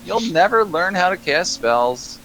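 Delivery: very high, slow taunting gnome voice; high-pitched female gnome sneering at a failed caster; mocking gnome woman. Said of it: "She's like".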